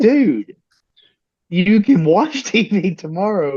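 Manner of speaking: can't believe he's crying over TV; incredulous dad. Retold or imitated. Dad telling his tearful son TV can wait, amused. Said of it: "And I said".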